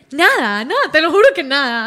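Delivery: loud, imitated nervous protest; re-enacted guilty protest, loud and swooping; nervous denial. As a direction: deny it too hard, as if caught lying. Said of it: "Cuando yo estoy nerviosa, me río".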